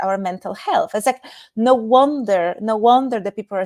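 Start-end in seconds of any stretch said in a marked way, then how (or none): none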